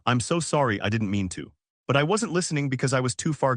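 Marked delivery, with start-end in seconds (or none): none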